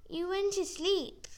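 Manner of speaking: put-on high, slow little-girl squeak; tiny squeaky voice. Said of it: "Molly squeaked in her tiny voice".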